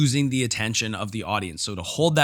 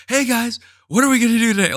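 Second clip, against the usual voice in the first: put-on high, loud, chirpy greeting to children; over-the-top kids' coach; 12 semitones higher, 7 dB louder, faster than usual. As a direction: project big and high like a kids' instructor. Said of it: "I'm not saying to talk like that".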